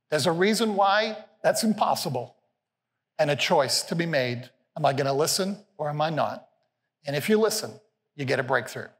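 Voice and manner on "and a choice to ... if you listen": ordinary talk, nothing marked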